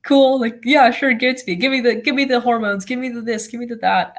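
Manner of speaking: high, bright-eyed eager reply; grinning teen brushing off side effects, high and eager; eager excitement. Retold or imitated. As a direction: pitch it up like you can't wait for the hormones. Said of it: "you're just sitting there like".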